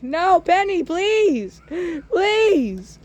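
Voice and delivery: high pleading whine; hammed-up high begging; desperate begging. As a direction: beg him not to leave. Begging Pennywise to stay.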